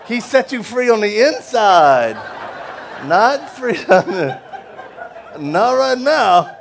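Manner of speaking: laughing through the words, high, loud and slow; getting the words out through real laughter, slow. Real, not acted. Preacher laughing at his own joke to prison kids, laughing.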